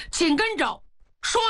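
usual speaking voice

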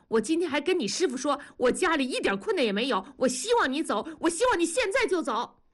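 fast, high-pitched protest, climbing to the end; heated outburst. A narrator's reading. Wife tells husband to go now, heated and high. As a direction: pour it out, pitch rising. Acted quarrel, wife's outburst.

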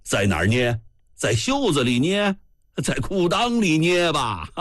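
a touch of coarse, mocking bluster; big coarse voice. A narrator's reading. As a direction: jeer in a big, rough voice. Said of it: "海张武的大粗嗓门".

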